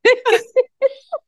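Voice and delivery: high shrieking burst of laughter; hysterical laugh. Real, not acted. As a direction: crack up helplessly, high and loud.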